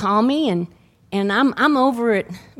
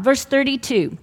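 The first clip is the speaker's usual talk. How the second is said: ordinary talk, nothing marked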